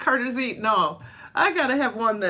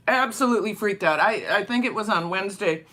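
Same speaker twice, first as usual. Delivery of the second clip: normal talking voice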